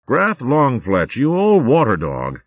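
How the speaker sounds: slow, loud, drawn-out welcome; hearty greeting. Acted. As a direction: greet an old friend with gusto. Hearty greeting between old friends.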